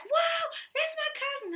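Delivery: high, loud, slowed, stretched "whoa"; excited cousin. Retold or imitated. Cousin shouting proudly to the crowd, in a high, loud, excited voice. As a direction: imitate a cousin cheering in delight. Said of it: "my cousin being like".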